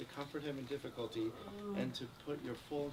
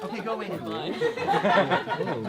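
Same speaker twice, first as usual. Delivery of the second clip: laughing; 5 semitones higher, 12 dB louder, much slower than usual. Real, not acted. Shooing dog, laughing.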